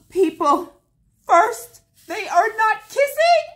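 high, loud thrilled outburst; excited gasp. Real, not acted. Crafter gasping at her drills, loud and high with excitement. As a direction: gasp and burst out, delighted.

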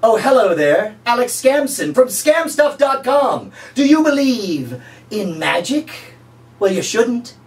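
high, slow, showy infomercial voice; cheesy pitchman. Pretend. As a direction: greet the viewer like a late night huckster. Pitchman retake.

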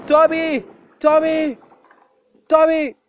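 loud high calls; calling out. Real, not acted. Player shouting for the dog Toby, loud call. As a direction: yell the name across the map.

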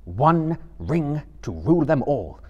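stern. A narrator's reading. Frodo draws himself up at Gollum's oath, stern. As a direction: rebuke with grave force. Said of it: "his stern voice".

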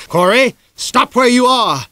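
loud, high, slow furious command; furious shout. Acted. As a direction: order him to stop, full of fury. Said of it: "he shouted, fury in his voice".

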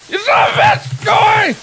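high, screamed curse at the chaser; furious shout. Real, not acted. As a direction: yell it in panic and rage. Scared by game chaser.

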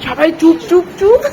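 put-on high, loud sing-song baby talk. Mother asking the baby what she eats, high baby talk. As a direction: chirp at the baby over her watermelon. Playing whisper game with baby.

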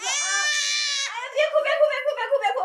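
put-on loud, panicked pleading with a screaming baby; frantic hushing. Young mother over her wailing baby, frantic loud shushing. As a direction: shout over the crying, desperate to calm it.

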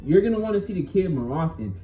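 ordinary talk

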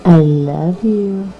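hushed doting voice; tender cooing. Real, not acted. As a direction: coo it softly like a goodnight to a little one. Mother cooing tenderly to child.

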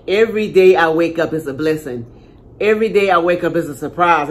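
ordinary talk

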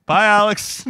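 high, bright mock goodbye; mock friend's sign-off. Teasing a teammate who calls while driving, high mock goodbye. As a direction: toss off a cartoonish bye.

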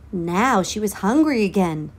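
plain reading voice

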